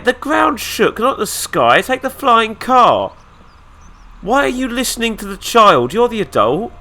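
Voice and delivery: high, loud exasperated rant; indignant rant. Pretend. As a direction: scold him, exasperated. Ranting at the game character.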